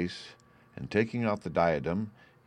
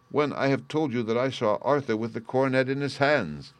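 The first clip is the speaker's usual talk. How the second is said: higher-pitched, exasperated insistence; impatient; 6 semitones higher than usual. A narrator's reading. Banker insisting on what he saw, impatient. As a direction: press the point with mild impatience. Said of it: "cried the banker impatiently".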